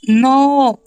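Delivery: high, loud wail of dismay; real loud, drawn-out protest; dismayed cry. Real, not acted. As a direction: cry out as the game goes wrong. Dismay after hitting spikes.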